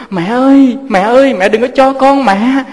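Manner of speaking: put-on high, loud child's sobbing plea; a small boy begging his mother not to give him away; desperate pleading cry.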